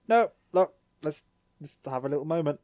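high loud start, brushing it off; Tai deflecting. Retold or imitated. Tai hiding tears from Agumon, high brisk deflection. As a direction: dodge the question fast. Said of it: "And Tai's like".

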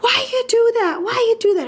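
put-on high, fast whiny child's yell; imitating son's scream. Mom imitates son screaming in the car, high and whiny. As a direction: mimic a teenage son yelling at his mom. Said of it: "And he's screaming".

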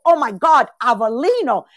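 light up at the name; excited recognition. Retold or imitated. Speaker greeting a stranger from her aunt's town, delighted.

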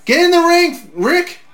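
loud, high yell at the screen; real fan shouting. Real, not acted. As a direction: call him into the ring like a fan. Yelling at the wrestler on screen.

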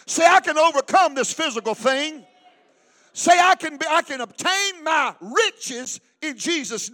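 loud lines for the crowd to echo; declarative preaching. Shown as a demonstration. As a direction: lead the declaration with force. Leads congregation in declarations.

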